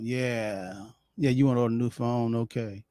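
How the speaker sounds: low, flat, uninterested clerk; bored phone clerk. Retold or imitated. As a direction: drone it like a bored rep. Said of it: "somebody on the other line".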